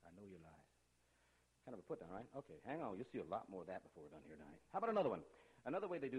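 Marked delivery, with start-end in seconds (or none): 0.1-0.7 "I know your lies" said much softer and lower; soft eerie echo. Shown as a demonstration. Teacher echoing the hidden message, soft and low. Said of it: "is the actual words they say".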